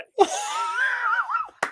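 cracking up at his own joke; big laugh. Real, not acted.

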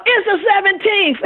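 high, called-out answer; impatient shoppers yelling out the date; crowd yelling out. Retold or imitated. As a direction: shout it out like the whole line at once. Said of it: "everybody else in the line yells out".

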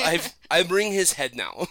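slow, hammed-up Russian accent; Nova, Russian accent. Dog bringing owner the head, slow mock Russian accent. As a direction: deliver a slow report as a Russian soldier. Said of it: "like a fucking Russian soldier".

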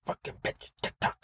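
unvoiced, very soft grumble; whispered cursing. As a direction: whisper it as the tantrum dies out. Rant dies into whisper.

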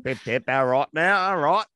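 hammy British catchphrases, rising at the ends; mock British accent. Co-host showing off their accent, mock British accent. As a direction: lay on a cartoon British accent. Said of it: "except for my British accent".

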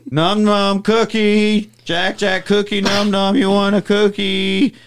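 high, slow sing-song coaxing; baby talk. Retold or imitated. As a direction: coax a baby back with a cookie.

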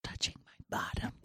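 very soft whisper. Acted. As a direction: whisper back what you heard. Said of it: "We are all whispering".

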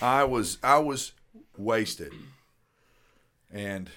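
usual speaking voice

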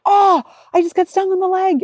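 imitating son. Mother quotes son stung by jellyfish, high child voice. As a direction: play the stung son, a bit whiny. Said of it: "he's like".

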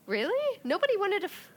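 high-pitched, startled disbelief; incredulous. Real, not acted. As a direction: jump up in pitch, can't believe it. Disbelief at friend's complaint.

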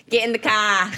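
a bad Boston accent. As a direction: bark it like a Boston tough guy. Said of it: "I can't do a Boston accent".

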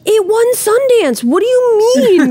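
high, slow incredulous whine; mock disbelieving voice. Artist scoffing at own Sundance win, high and slow. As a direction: play the baffled artist, high and drawn out. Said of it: "I'm like".

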